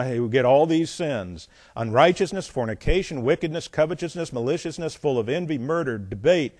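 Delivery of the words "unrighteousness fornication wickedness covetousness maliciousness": ordinary talk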